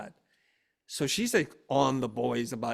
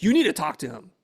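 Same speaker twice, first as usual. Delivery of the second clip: loud, raised-pitch order; insistent demand; 4 semitones higher, 11 dB louder than usual. Retold or imitated. Wife telling husband to deal with son, insistent demand. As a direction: push it at him forcefully.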